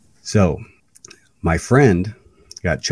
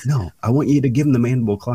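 higher, brisk acted instruction; Triple H; 6 semitones higher, faster than usual. Triple H telling Bray Wyatt the move, acted out. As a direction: quote the boss giving a quick order. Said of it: "just imagine Triple H like".

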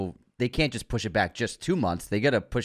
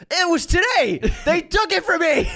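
high anguished shout, louder; Tobey Maguire meme; 5 dB louder than usual. Retold or imitated. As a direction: wail it like a desperate meme. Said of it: "that video meme of Tobey Maguire in Pawn Sacrifice saying".

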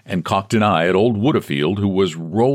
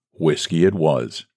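usual speaking voice

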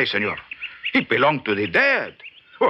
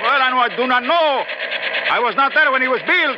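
put-on shouting over engine, Mexican accent; 13 semitones higher than usual. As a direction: point it out at full voice. Shouting over helicopter noise.

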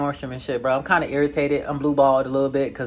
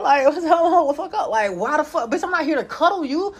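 indignant outrage; 11 semitones higher than usual. Retold or imitated. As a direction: throw it at her, offended. Arguing with the girl in story.

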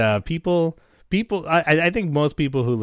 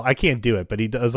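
usual speaking voice

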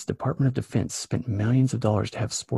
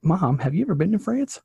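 mock teen retort; 8 semitones higher, 5 dB louder than usual. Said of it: "I was like".

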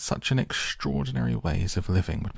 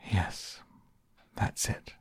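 sighing; 4 dB quieter, slower than usual. Acted. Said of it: "with a sigh".

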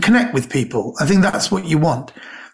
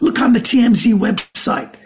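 urgent agent; 8 semitones higher than usual. Retold or imitated.

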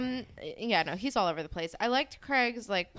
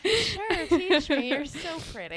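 put-on smitten girl voice; 9 semitones higher, slower than usual.

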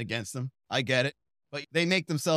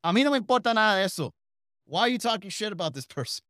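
mock scolding grandma; 6 semitones higher, faster than usual. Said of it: "be like".

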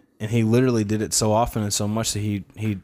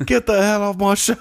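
hammed-up angry TV chef, louder, with a laugh; mock chef yell; 10 semitones higher, 5 dB louder than usual. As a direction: bark it out like a mock celebrity chef.

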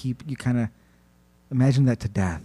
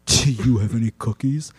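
Santa; 2 semitones lower than usual. Acted. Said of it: "This is what Santa does".

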